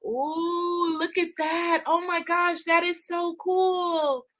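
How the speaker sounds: high, drawn-out wondering coo; high-voiced, delighted wonder; real awe. Real, not acted. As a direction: marvel with long, sustained vowels. Awed by the pictures.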